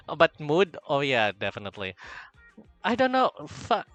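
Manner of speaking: normal talking voice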